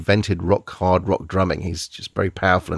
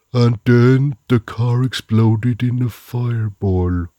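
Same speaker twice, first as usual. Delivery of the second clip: slow, drowsy monotone narration; sleepy narrator impression; 3 semitones higher, 4 dB louder, much slower than usual. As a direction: drone it like you're on sleeping pills. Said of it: "it sounds like he's on sleeping pills".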